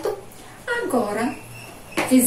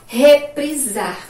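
"Reprisar" slow and louder; slow dictation; 3 semitones higher, 5 dB louder, much slower than usual. Shown as a demonstration. Teacher giving the class the next word, slow dictation. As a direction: dictate it slowly and firmly.

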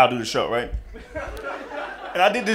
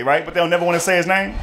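normal talking voice

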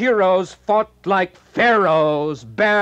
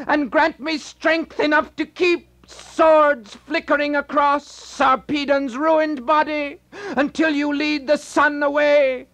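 desperate prayer; 10 semitones higher, faster than usual. Acted. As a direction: cry out to Apollo for help. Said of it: "Glaucus prayed to Apollo".

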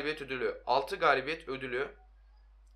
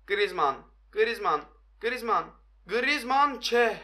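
name calls climbing higher each time; mounting commentator-style suspense, ending high; building excitement; 5 semitones higher, much slower than usual. Real, not acted. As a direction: urge the striker on, rising to a cry. Calling the striker as attack builds.

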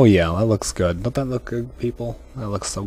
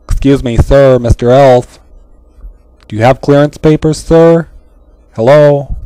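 loud, rising, insistent calls; raised voice asking for clearance papers; calling out loudly; 4 semitones higher, 10 dB louder than usual. Acted. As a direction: demand his papers across the room. Calling out to the elf.

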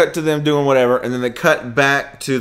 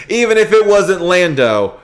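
usual speaking voice

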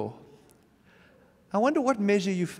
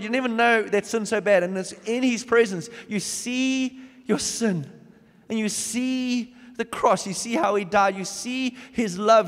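rising, louder preaching with fast then slowed lines; fervent preaching; 3 semitones higher, 4 dB louder than usual. Acted. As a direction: press each "You see" home to the congregation. Preaching builds to full force.

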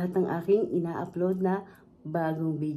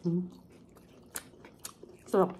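talking while chewing noodles; muffled mouth-closed hum; chewing with mouth full; 7 dB quieter, faster than usual. Real, not acted. Seen 0: Mouth closed and working after slurping noodles at -1.0; no R frame shows it.

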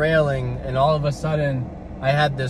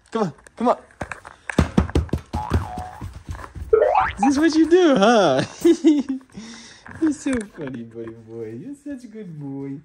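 much higher, sing-song pet voice; 8 semitones higher than usual. Real, not acted. Praising the pet in high baby talk. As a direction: coo at him adoringly.

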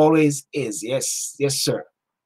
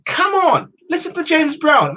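high-pitched, fired-up urging; real rising excitement; excited; 9 semitones higher than usual. Real, not acted. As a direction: urge them on, voice leaping up. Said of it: "Man, I'm getting excited".

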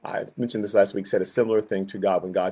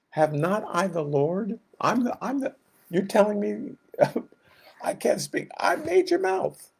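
much higher, disbelieving, half-laughing retort; incredulous God; 10 semitones higher, 3 dB quieter than usual. Acted. God scoffing at Moses' excuse, incredulous. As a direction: scoff at the excuse, then a whispered aside.

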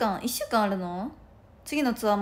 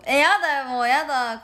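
whiny protest; 4 semitones higher, 7 dB louder than usual. Real, not acted. Whining at pressure.

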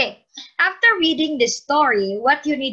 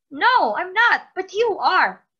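higher, louder, slow indignant comeback; accusing retort; 8 semitones higher, 4 dB louder, slower than usual. Acted. Boy blaming his friend for the mess, indignant retort. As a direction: throw the blame right back at him. Boys quarrel after falling in trash.

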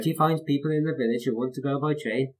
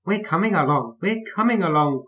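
high singsong answer; calling back; 7 semitones higher than usual. A narrator's reading. Annie and Clarabel answering Thomas, calling in singsong. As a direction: call back in a higher lilt. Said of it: "called Ally and Clarabel".

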